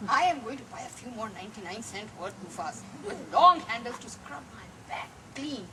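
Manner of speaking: ordinary delivery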